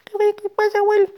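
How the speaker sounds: very high squeak; tiny little voice. Acted. Ant calling to crying grandma, tiny squeaky voice. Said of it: "escucha una vocecita".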